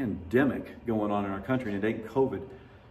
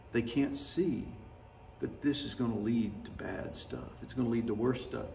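tearful musing; 8 dB quieter than usual. Retold or imitated. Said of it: "I began to weep a little bit".

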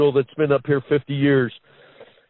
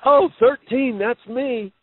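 much higher playful dad call, fading at the end; joking dad; 8 semitones higher, slower than usual. Retold or imitated. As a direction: call the number like a proud old player. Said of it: "and then he'd go".

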